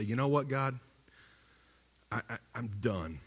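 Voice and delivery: quiet surrender. Retold or imitated. Pastor telling God he gives up, quiet and low. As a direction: let it out quietly, defeated.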